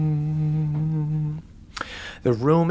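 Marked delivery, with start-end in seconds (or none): 0.1-1.4 long, held wind hum; droning hum. Acted. Game master imitates wind echoing in the cave, droning hum. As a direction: hum like wind in a hollow room. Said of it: "causing a consistent hum".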